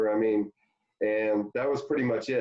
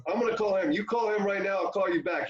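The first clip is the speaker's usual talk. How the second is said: put-on higher, eager old manager's sales pitch; Tommy Lasorda impression; 10 semitones higher than usual. Lasorda cold-calling Kevin about Japan money, high hearty pitch. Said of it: "he goes".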